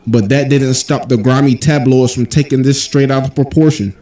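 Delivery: usual speaking voice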